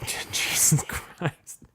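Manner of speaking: breathless whispered laugh. Real, not acted. Host cracking himself up at his crude joke, whispering. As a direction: whisper it through a laugh, slowly.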